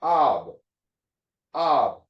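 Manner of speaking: "ab" said twice, each a separate syllable; sounded-out syllable. Shown as a demonstration. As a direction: drill "ab", open "ah" closed by b, with a pause. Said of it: "the bayit has a ba sound".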